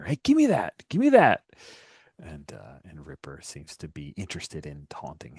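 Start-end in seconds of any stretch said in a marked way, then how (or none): 0.0-1.4 raised-pitch yell, building louder; demanding shout, climbing on the repeat; yelling after someone. Acted. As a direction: call after someone running off with your things. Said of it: "yelling after her".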